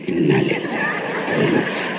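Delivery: low and whispered, very slowly drawn out; whispered slow sigh. Pretend. Husband turning away from nagging wife, whispered and slow. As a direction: breathe it out under your breath, dragging it.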